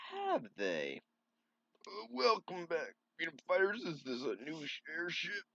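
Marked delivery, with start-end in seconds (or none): 1.9-5.4 a made-up voice for Sally's father greeting the heroes; odd put-on voice for the king. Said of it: "I don't know why I gave him that voice".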